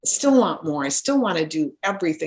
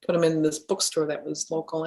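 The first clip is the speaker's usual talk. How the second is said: ordinary talk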